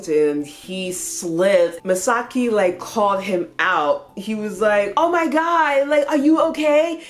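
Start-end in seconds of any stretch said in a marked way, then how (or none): 5.0-7.0 loud, high mock panic; raised-pitch alarmed outburst; freaking out, loud. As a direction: imitate someone freaking out over a fall. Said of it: "while freaking out, being loud".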